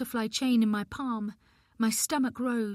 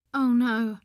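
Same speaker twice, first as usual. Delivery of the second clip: a loud "oh no" of dismay; sudden dismay, louder; alarmed outburst; 2 semitones higher, 7 dB louder than usual. Acted. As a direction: let it burst out in alarm. Dismay at social services news.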